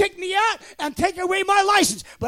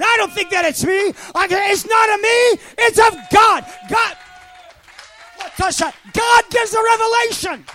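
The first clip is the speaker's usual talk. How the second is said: full-force preaching; 6 semitones higher, 5 dB louder than usual. Real, not acted. Pastor preaching to the church about God's revelation, higher and louder. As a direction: preach it out to the back row.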